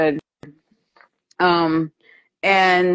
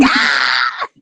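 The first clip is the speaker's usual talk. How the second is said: mock yell; 8 semitones lower than usual. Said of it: "he's like".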